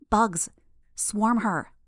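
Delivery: short breathy gasps between words; panting through the words; out of breath. Acted. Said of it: "taking lots of little breaths as she said it".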